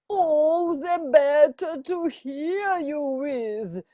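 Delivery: a hint of a squeaking fake granny; squeaky disguised wolf. Wolf in Granny's bed answering the girl, lightly squeaky. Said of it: "squeaks the wolf".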